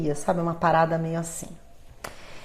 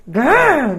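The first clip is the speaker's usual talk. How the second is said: a short, louder self-annoyed growl; frustrated growl at herself; 4 semitones higher, 6 dB louder than usual. Retold or imitated. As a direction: growl at your own mistake. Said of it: "eu paro e falo".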